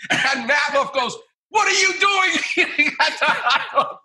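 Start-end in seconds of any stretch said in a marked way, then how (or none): 1.5-4.0 much higher, laughing mock shout of a stern surgeon; mock boss shout. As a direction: call him out, half-laughing. Boss catches intern on TV.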